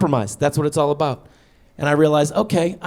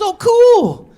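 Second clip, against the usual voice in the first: high, loud breezy wife; mock cheerful wife; chirpy wife; 8 dB louder than usual. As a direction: toss it off brightly like a carefree wife. Wife's bright reply.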